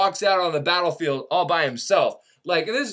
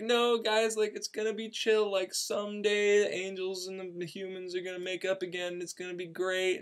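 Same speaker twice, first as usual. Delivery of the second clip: soothing reassurance; 9 dB quieter than usual. Retold or imitated. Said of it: "he's saying, like".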